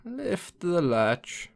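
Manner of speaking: put-on high, very slow old woman calling; frail grandmother. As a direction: call out thinly from the bed. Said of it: "called out the grandmother".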